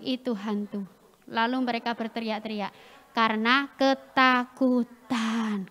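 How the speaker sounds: measured, subdued read-aloud; group scripture reading. Acted. Preacher leads children reading the verse together, measured group scripture reading. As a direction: read evenly so a group can follow.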